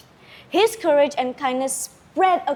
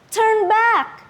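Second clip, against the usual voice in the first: much higher, louder pleading cry; begging crew; 10 semitones higher, 4 dB louder than usual. Acted. Crew begs Lagaligo to turn back, pleading. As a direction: plead desperately with him. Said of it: "his crew begged".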